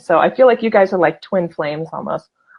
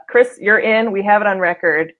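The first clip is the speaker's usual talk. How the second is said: usual speaking voice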